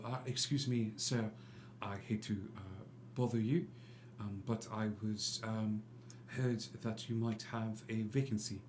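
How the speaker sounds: lightly voiced soft-spoken Frenchman; a hint of a polite French gentleman, kept soft; gentle courteous stranger asking about a vacancy; suave stranger. GM voices Lestrange, quiet.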